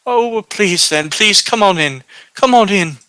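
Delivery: put-on high, insistent old hostess; welcoming old woman. Old woman welcomes guests inside.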